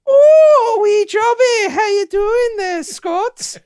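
high falsetto Scottish nanny voice, loud; hammed-up Scottish accent in a shrill old lady's falsetto; Scottish Madam Doubtfire. Seducing Scott after time travel, in a loud falsetto Scottish accent. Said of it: "with, uh, my Scottish accent".

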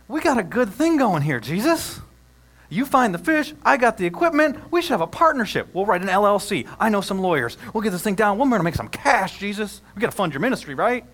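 loud, high, pushy salesman pitching a deal; mock business pitch. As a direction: sell it like a slick hustler, half joking. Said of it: "Peter doesn't say to Jesus".

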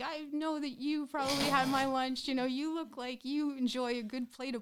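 imitated yelling patient. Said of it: "he's like".

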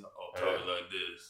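lightly voiced low rasp, slowed; a hint of a weary growl; raspy sick old guy voice. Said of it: "he talks in the old sick guy voice".

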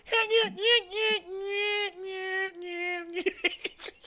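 high wheezing laugh. Real, not acted. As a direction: laugh till it cracks. Laughing at audio cutting out.

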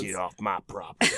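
put-on grumpy old man.

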